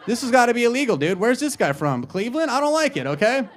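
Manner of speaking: high, animated objection; mock indignation. Comic objecting to a weirdo in the chat, mock indignant. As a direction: protest in comic disbelief.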